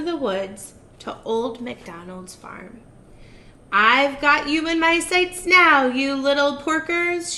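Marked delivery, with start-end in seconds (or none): 3.7-7.4 louder, gloating witch cackle-talk; cackling witch. Acted. Witch cackling at the piggies from her broom. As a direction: cackle it down at the prey. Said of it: "she cackled".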